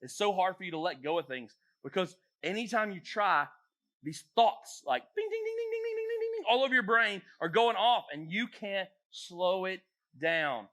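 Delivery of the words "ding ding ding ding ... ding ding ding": very high-pitched ringing dings; high, rapid-fire bell imitation; rapid imitated dinging. Shown as a demonstration. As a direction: rattle off dings like alarms going off. Imitates racing thoughts going off.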